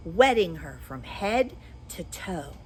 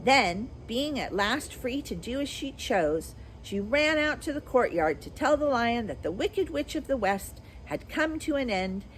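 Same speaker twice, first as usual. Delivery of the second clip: plain reading voice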